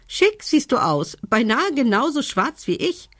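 high-pitched, teasing bird voice; giggling raven. A narrator's reading. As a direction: tease her in a high, tittering raven voice. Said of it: "kicherte Doktor Krehi".

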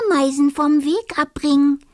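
high, fast, laughing; giggling. Acted. Dormouse giggles about pestering ants. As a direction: giggle at the naughty idea.

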